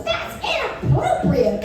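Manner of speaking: high, drawn-out heckler voice; mock outraged yell. Offended woman heckling a comic's joke, mock outraged yell. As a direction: imitate a scandalised audience member calling out. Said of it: "this woman yells".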